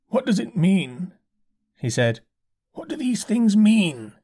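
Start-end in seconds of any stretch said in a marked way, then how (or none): 0.1-1.1 high, slow, staring-blank curate voice; vacant curate. A narrator's reading. Dazed curate asking the narrator, high and slow. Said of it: "He spoke abruptly". 2.7-4.1 high, slow, bewildered curate; dazed curate. A narrator's reading. Curate pleading with the narrator, high and slow.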